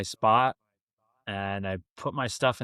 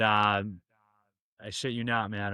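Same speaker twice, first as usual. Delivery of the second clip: usual speaking voice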